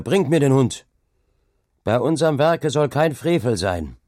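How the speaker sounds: shouted order. A narrator's reading. Hauke demands the dog from his workers, shouted order. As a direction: shout a stern command. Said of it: "schrie Hauke wieder".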